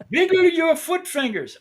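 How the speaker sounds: high, slow mock Indian accent; imitating the Indian technician. Technician repeating his instruction, mock Indian accent. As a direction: repeat the odd phrase in the technician's voice. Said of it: "I said, "What'd you say?".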